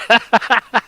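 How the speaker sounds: gloating laugh. Acted. Gloating over rival's losses.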